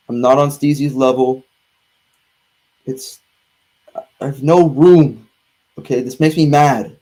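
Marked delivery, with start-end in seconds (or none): none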